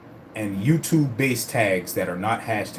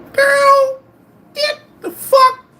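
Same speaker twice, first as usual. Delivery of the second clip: very high, loud, drawn-out yell; shout; 9 dB louder, much slower than usual. Real, not acted. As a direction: scream it out in disbelief, slowly. Yelling at retold woman.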